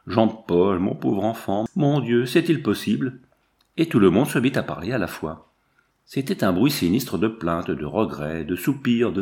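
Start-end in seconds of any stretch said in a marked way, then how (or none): none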